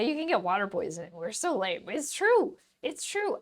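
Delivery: ordinary delivery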